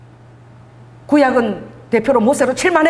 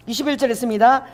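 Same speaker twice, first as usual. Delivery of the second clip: normal talking voice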